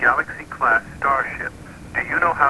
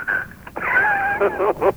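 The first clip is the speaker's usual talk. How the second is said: very high, slow, stretched-out squeal. Acted. Computer refusing in a slow warped squeal.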